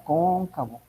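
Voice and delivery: "Cóncavo" with the stress on the first O, KÓN-ca-vo. Shown as a demonstration. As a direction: lean on the opening syllable of "Cóncavo". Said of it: "lleva acento en la O".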